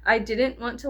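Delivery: normal talking voice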